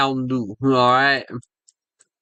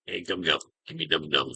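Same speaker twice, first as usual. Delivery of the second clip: mumbled take; 9 semitones lower, 9 dB quieter than usual. Shown as a demonstration. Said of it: "Or, excuse me".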